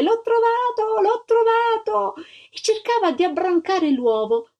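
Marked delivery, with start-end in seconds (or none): 0.0-1.0 high, shrill-free excited shout of discovery; joyful cry. A narrator's reading. Little girl to everyone, shouting she found the egg. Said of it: "si mise a gridare". 1.0-2.1 high, slow, stretched shout of triumph; drawn-out joyful cry. A narrator's reading. Little girl repeating her find, slowly crying out. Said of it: "si mise a gridare".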